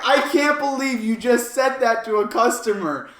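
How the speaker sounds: emphatic, scandalized reaction; incredulous. Retold or imitated. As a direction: exclaim it, still reeling from the shock. Retold shock at guard.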